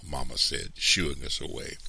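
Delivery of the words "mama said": ordinary delivery, nothing marked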